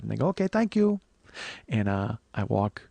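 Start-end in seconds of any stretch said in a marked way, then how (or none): 0.3-1.0 high, clipped falsetto thanks; lightly voiced desk lady; high falsetto thank-you; imitating the receptionist.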